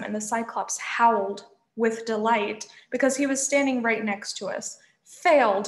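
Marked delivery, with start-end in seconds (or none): 5.0-5.7 louder, mildly gloating cry; gleeful yell. A narrator's reading. Cyclops gloating over the heroes, gleeful yell. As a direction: crow it out with glee. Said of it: "he yelled gleefully".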